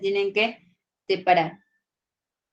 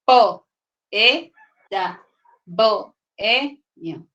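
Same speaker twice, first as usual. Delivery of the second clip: loud separate syllables, each one punched; loud syllable-by-syllable split; syllables pulled apart; 6 dB louder, much slower than usual. Shown as a demonstration. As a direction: hammer out every syllable on its own. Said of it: "la palabra no se entendería".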